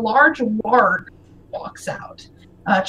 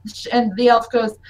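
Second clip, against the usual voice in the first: normal talking voice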